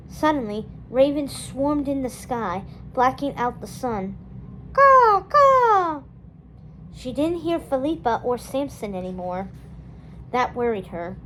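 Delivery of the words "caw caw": high, loud raven cries; raven caw. A narrator's reading. Ravens swarming the sky, loud high caws.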